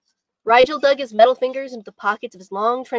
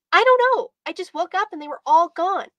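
put-on high, loud child's outburst; upset young boy; 7 semitones higher, 4 dB louder than usual. A narrator's reading. Boy can't explain his parents vanishing, upset young boy. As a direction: burst out high like a distressed kid.

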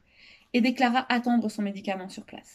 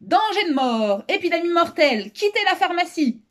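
raised, higher alarm announcement; urgent public warning, pitched up; declaring a warning; 7 semitones higher, 4 dB louder than usual. A narrator's reading. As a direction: sound the alarm to clear everyone out. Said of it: "ouvrit la porte et déclara".

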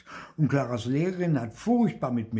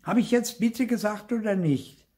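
put-on high, loud child's challenge; cheeky high-pitched retort; defiant challenge; 12 semitones higher, 5 dB louder than usual. A narrator's reading. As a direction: demand an answer, high and insistent. Clara defiantly challenges her sibling.

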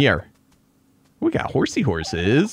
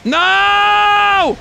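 high, loud anguished no; despairing shout; 6 dB louder than usual. Real, not acted. Gamer watching the trap fail, loud despairing shout. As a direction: scream it as the trap fails.

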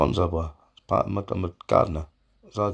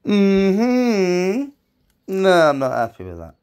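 mock disapproval; 12 semitones higher, 9 dB louder, slower than usual. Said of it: "and I'm thinking".

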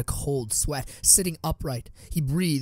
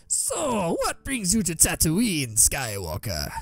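higher, slow, sly alien shopkeeper; Watto's voice; 4 semitones higher, slower than usual. A narrator's reading. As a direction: probe with a greedy curiosity. Watto questions Anakin.

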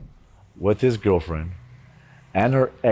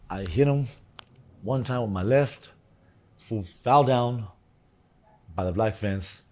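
usual speaking voice

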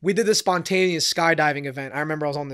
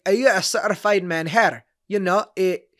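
higher-pitched imitated Scottish accent; 4 semitones higher, 5 dB louder than usual. Drunk Scottish woman explaining herself to the comic, mock Scottish accent. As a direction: do a hammed-up Scottish brogue. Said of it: "my best Scottish accent".

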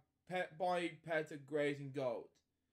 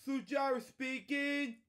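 higher, louder cheerful welcome; bright show intro; 7 semitones higher, 6 dB louder than usual. Acted. Host opening a bedtime story video, cheerful and louder. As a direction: greet the viewers like a kids' show host.

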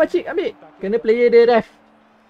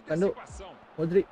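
ordinary delivery

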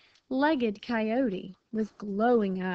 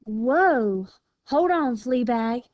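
loud objection; 3 semitones higher, 7 dB louder, slower than usual. A narrator's reading. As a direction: call a firm stop. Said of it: "replied Jilly".

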